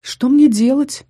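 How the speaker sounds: slow, loud desperate plea; close to tears. A narrator's reading. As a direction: ask slowly, near tears. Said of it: "чуть не со слезами на глазах".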